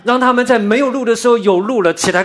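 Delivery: high, loud preaching; forceful preaching. Acted. Preacher tells congregation of a way to follow Jesus, forceful preaching.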